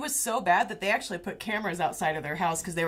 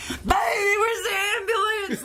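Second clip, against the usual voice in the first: much higher, louder hammed-up sob; mock crying wail; 14 semitones higher, 4 dB louder than usual. Beaten guy calling for the ambulance, mock sobbing. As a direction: wail it like a baby in pain. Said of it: "there's the guy".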